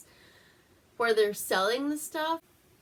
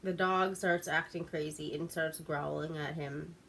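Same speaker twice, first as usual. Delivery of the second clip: usual speaking voice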